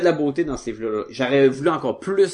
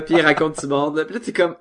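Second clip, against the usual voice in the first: ordinary talk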